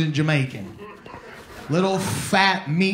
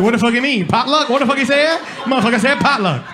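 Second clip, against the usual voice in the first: put-on indignant Jamaican accent, louder and higher, laughing through it; Jamaican heckler; 6 semitones higher, 5 dB louder than usual. Audience guy mishearing Potter as potluck, loud Jamaican indignation. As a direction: mishear the name and get offended in patois. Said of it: "my boy's back here like".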